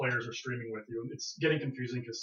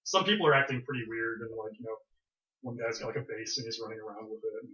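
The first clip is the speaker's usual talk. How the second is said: ordinary talk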